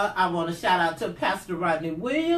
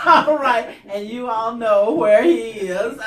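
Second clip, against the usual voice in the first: laughing; 8 dB louder than usual. Real, not acted. Laughing about the pastor.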